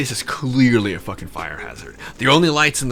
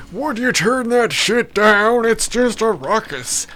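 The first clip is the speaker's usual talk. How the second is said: put-on high, slow cranky old-timer complaining; grumpy old man; 10 semitones higher, 3 dB louder, slower than usual. Old man yelling at the punk music, slow and high. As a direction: whine it like a fed-up eighty-year-old. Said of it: "I feel like I'm eighty".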